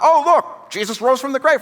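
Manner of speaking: high, loud wide-eyed exclamation; hammed-up excited surprise, pitch leaping; mock amazement. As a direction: act out an instant, naive discovery. Mock instant belief, acted quote.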